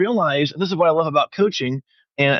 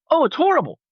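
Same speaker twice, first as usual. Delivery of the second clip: much higher, lightly voiced ashamed client; mock client voice; 14 semitones higher, faster than usual. Coach imitating an ashamed client to the host, higher voice. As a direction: play the sheepish client, pitched up.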